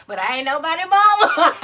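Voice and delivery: high, loud laughing delivery. Real, not acted. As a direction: crack up while saying it. Joking about being nobody's mama.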